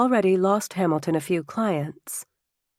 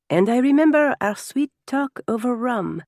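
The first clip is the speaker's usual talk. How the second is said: put-on higher, fond French-accented reminiscence; fond French guest; 7 semitones higher than usual. French guest recalls time with hostess, higher and fondly teasing.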